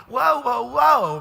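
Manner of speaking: loud, high, emotional whoa; overwhelmed whoa. Retold or imitated. Pastor reliving his reaction to the friend's offer, loud overwhelmed whoa. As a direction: shout the whoas, overwhelmed. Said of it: "I'm going".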